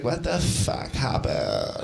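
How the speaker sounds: slow, quiet, dazed morning-after voice; a woman waking up lost and muddled; groggy girl. Retold or imitated. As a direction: drag it out, half awake and confused. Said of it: "she's like".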